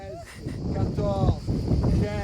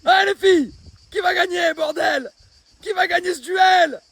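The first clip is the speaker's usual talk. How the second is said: loud, high excited yelling; cheering loudly; 8 semitones higher, 5 dB louder, slower than usual. Real, not acted. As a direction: hype up the duel. Refereeing the arm wrestle.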